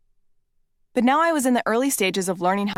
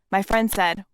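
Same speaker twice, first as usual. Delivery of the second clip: ordinary delivery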